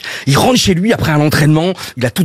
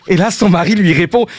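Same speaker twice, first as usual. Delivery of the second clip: normal talking voice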